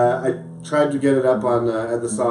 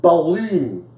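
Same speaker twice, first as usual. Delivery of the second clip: playful Scottish accent, higher pitch; 4 semitones higher than usual. Shown as a demonstration. Host showing off the funnest word, playful Scottish accent. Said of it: "funnest word to say in a Scottish accent".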